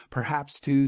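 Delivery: ordinary delivery, nothing marked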